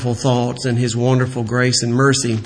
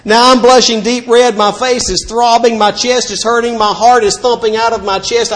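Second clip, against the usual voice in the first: retold panic; 7 semitones higher than usual. Retelling panic, heart thumping.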